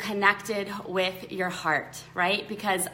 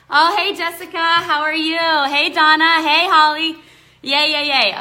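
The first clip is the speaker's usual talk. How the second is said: higher, louder happy hellos; excited greetings; 6 semitones higher, 9 dB louder than usual. Real, not acted. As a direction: greet each arriving friend with delight. Greeting viewers joining live.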